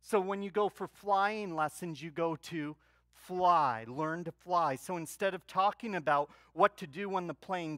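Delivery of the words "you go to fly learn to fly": ordinary talk, nothing marked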